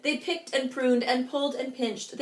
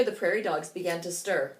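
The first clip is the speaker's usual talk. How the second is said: plain reading voice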